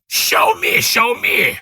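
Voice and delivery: a whispered rasp rising to a high cry; hoarse thin voice. A narrator's reading. Hidden guest calling out, hoarse and thin. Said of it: "a hoarse, thin voice suddenly rang out".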